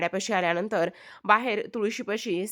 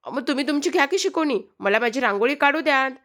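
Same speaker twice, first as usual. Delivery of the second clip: higher, louder defiant retort; defiant girl; 5 semitones higher, 4 dB louder than usual. A narrator's reading. Savi hotly defying the teacher over her rangoli. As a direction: talk back to the teacher.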